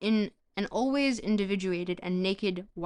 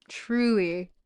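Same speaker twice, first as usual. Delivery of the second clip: ordinary delivery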